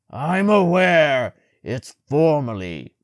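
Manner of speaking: loud gruff snap, slow; gruff angry. A narrator's reading. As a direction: bite out each word in anger. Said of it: "in a particularly gruff voice".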